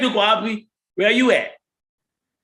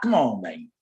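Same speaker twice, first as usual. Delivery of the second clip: ordinary talk, nothing marked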